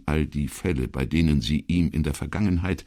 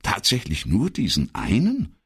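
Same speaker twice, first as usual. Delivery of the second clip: puzzled question, pitched up; baffled; 8 semitones higher than usual. A narrator's reading. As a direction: ask it in mild bewilderment, higher. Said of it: "fragte Brunetti verdutzt".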